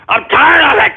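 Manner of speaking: sudden high-pitched angry yell; fed-up outburst. Acted. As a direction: snap into a frustrated shout. Fed-up outburst breaks friendly attempt.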